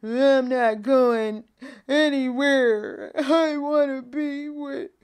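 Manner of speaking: put-on high, slow, whining toddler voice; mock toddler crying for a toy; whiny two-year-old. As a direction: whimper like a toddler who won't leave. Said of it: "It's like the little two-year-old".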